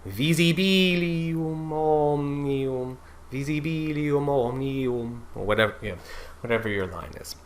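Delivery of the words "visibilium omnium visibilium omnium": slow, softer "Visibilium" with light weak syllables; E vowel. Shown as a demonstration. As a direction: sing "visibilium" leaning to E. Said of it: "making it more E".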